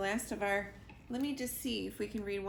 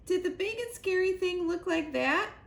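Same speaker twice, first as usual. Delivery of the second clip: high chick voice; 10 semitones higher, 6 dB louder than usual. A narrator's reading. Sugar questions Tail.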